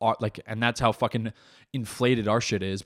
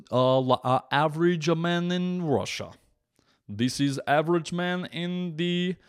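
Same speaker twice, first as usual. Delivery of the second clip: higher, slow mock Russian voice; earnest fake Russian insistence; mock Russian accent; 4 semitones higher, slower than usual. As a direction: lay on a broad Russian accent, slowly.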